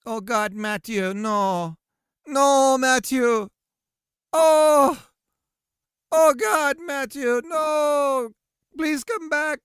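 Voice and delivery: high, loud hammed-up wail; mock anguish. Streamer begging Matthew to return, mock anguished cries.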